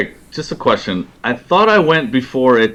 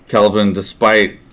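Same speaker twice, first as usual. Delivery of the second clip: ordinary delivery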